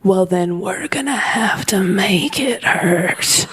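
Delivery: slow, eerie whispered threat; menacing whisper. Retold or imitated. Mom threatening her child, slow sinister whisper. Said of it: "in a voice I'd never heard".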